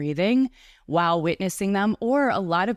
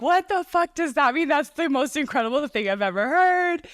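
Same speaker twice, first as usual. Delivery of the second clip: freaking out; 9 semitones higher than usual. Retold or imitated. Retelling her podcast guest's words, freaking out with high excitement. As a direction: gasp it out like it's the best thing you ever heard. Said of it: "freaking out".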